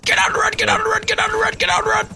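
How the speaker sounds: 1 panicked yelling. Real, not acted.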